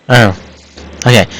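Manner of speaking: ordinary delivery, nothing marked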